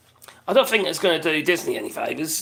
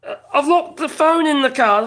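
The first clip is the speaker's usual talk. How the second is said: flustered neighbour; 9 semitones higher, 5 dB louder than usual. Retold or imitated. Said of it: "She was trying to".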